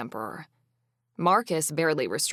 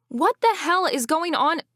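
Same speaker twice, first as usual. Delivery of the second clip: high, loud outraged shout; 12 semitones higher, 6 dB louder than usual. Acted. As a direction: demand it at full voice. Outraged shout entering throne room.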